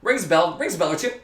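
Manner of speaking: ordinary talk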